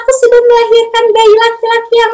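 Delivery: high, loud delighted voice; joyful news. Acted. Mother tells her father-in-law her son is born, joyful. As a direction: share the news with glowing joy. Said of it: "Dia bawa nih bayinya".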